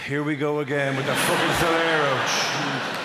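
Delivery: slow, drawn-out imitation of a fed-up wife; lightly voiced long-suffering resignation; mock weary wife. Wife silently despairing at her ice-cream inventor husband, mock weary. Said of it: "This glorious look of".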